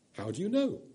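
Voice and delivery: put-on a high, curious boy's question; puzzled boy. A narrator's reading. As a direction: ask it like a wondering child. Shasta asks.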